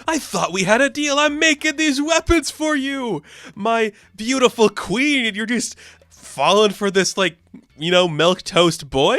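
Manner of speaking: high, hammed-up jealous outrage; angry Garguax. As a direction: whine in wounded mock fury. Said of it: "angry Garguax".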